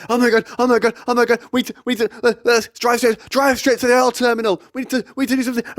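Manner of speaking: imitating a friend's high-pitched panic; hammed-up hysterical passenger; panicked screaming. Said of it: "He's screaming in my ear".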